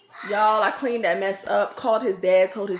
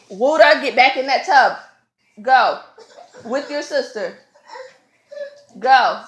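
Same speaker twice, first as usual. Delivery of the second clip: real loud parental command; stern order; 4 semitones higher, 8 dB louder, slower than usual. Real, not acted. Ordering child back to tub.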